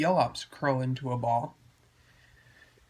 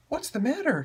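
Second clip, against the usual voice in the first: high character voice; 12 semitones higher than usual. Acted. Feisty speaks to friends.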